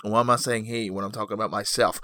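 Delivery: ordinary delivery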